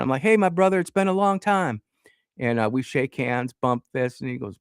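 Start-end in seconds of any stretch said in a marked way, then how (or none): 0.2-1.8 high-pitched mock reunion greeting; playing best friends. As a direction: play along as if greeting an old buddy. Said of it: "like I'm his best friend".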